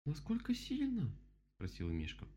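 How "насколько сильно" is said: very high, slow bear question; voiced bear character. A narrator's reading. Bear asks about the flood, high character voice. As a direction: ask it high, drawing it out.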